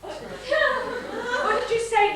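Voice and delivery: can't hold the laughter in as she asks; laughing. Acted. Wife asking Nicky through delighted laughter.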